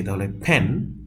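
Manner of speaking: ordinary delivery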